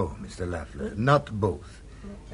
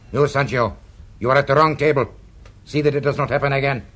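louder and brisk scolding of a servant; sharp reprimand; 4 semitones higher, 6 dB louder, faster than usual. Acted. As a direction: cut him down in front of guests. Owner scolds waiter.

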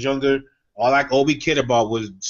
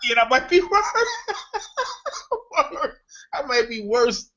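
high-pitched, through real laughter; cracking up while talking; helpless giggly agreement; laughing; 12 semitones higher than usual. Real, not acted. Cracking up at the joke.

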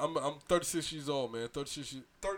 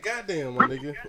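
ordinary delivery

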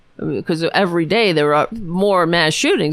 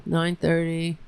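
normal talking voice

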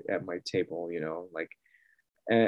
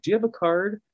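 lightly voiced visitor, pitched much higher; a high, eager booth visitor asking; mimicking a customer; 11 semitones higher than usual.

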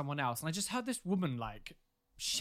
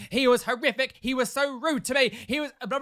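mock complaint; 6 semitones higher, 6 dB louder than usual. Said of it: "which was like".

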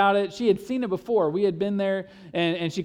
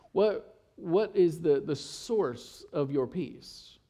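earnest soft; 4 semitones lower, 4 dB quieter, much slower than usual. Real, not acted. Pastor's earnest question.

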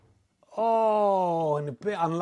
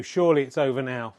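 ordinary delivery

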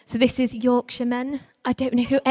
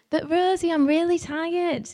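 put-on whiny tired girl; 6 semitones higher than usual. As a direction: drag it out like a weary kid. Girl whining to leader in maze.

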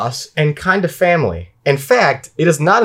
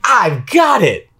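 high, drawn-out mock triumph; acted eureka; 8 semitones higher, much slower than usual. Player voices own brain on the clue, mock triumph. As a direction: exclaim it slowly like a sudden brainwave. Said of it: "my brain was like".

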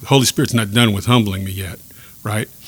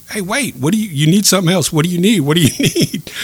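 higher, louder urgent call; calling after him; 8 semitones higher, 6 dB louder than usual. Retold or imitated. Calling after Larry as he walks away, urgent raised voice. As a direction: shout to stop him walking off.

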